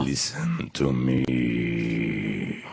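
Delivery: very low, very slow Russian growl; Russian tiger growl. Shown as a demonstration. Actor shows the tiger's growl to the hosts, very low and very slow Russian. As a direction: growl it out like a big cat. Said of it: "like a tiger growl to it".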